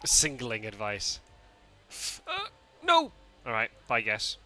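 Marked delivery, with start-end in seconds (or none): 2.3-3.1 startled no. Real, not acted. Reacting on stream.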